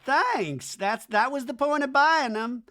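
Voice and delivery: high, emphatic mock-thanks; high, sassy quoted comeback; sassy retort. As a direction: pitch it up like an obvious point. Acts Madison's retort to compliment.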